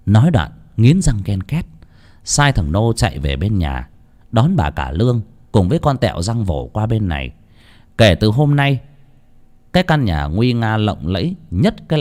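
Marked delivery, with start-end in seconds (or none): none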